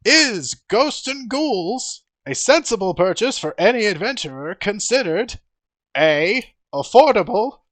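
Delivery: high, loud trivia host; pompous showman quizmaster; Volo's game show voice. Acted. Volo keeps hosting.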